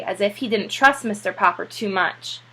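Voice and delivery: plain reading voice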